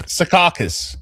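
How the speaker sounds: Secaucus said as "se-KAW-kus", stress on the middle syllable; correct pronunciation. Shown as a demonstration. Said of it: "The, the correct pronunciation is".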